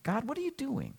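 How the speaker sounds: soft, high, hushed question; wondering prayer. Acted. Believer in the grocery line asking God, wondering prayer. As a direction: ask God quietly, in wonder.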